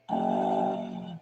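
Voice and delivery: low flat groan; low grunt. Retold or imitated. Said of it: "I go".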